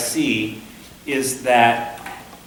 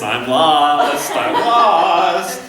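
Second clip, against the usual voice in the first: laughing, louder mock I'm lost; mock lost conductor; 3 semitones higher, 5 dB louder, much slower than usual. Conductor jokingly acts out being lost, laughing and louder. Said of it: "this is".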